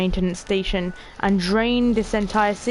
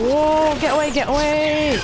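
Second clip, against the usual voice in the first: high, louder get away; real startled alarm; startled panic; 6 semitones higher, 3 dB louder than usual. Real, not acted. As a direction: shoo off attackers in alarm. Attacked underwater in game.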